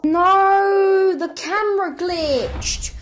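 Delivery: high, wailing protest; exasperated outcry. Real, not acted. Gamer protests the camera glitch, exasperated. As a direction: complain loudly, pitched right up.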